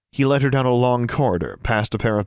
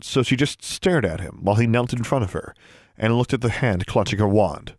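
plain reading voice

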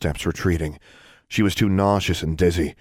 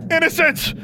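high scream of a name across the cave; frantic high shout; desperate scream. A narrator's reading. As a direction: scream her name to stop her. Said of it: "Alexandra yelled".

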